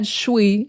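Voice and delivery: "shwe" as one syllable; only the sh of "should" before "we"; reduced "should we". Shown as a demonstration. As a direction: blend it into "shwe". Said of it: "it's probably "should we".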